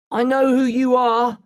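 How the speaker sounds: high, narrated mild scream. A narrator's reading. Boy confronting the bird smuggler, screaming. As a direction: raise the pitch to confront him. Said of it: "I scream at him".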